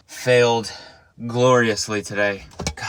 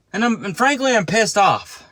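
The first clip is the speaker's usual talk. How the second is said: higher, louder mock fury; mock angry rant; 9 semitones higher, 4 dB louder than usual. Griping to the podcast hosts, hammed-up angry rant. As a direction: ham up being pissed off. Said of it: "I'll be like".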